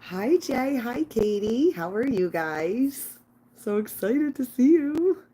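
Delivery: high, loud happy hellos; light up as the viewers arrive; excited greeting. Real, not acted.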